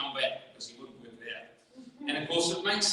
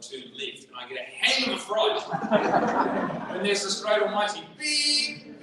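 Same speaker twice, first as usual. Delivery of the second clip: ordinary delivery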